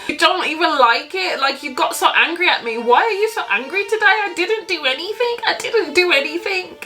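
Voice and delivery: imitating a high-pitched crying complaint; frustrated tearful protest. Girlfriend asking why he's angry at her, frustrated tearful protest. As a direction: plead upset, pitch climbing, as if crying. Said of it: "I get frustrated, and I start to cry".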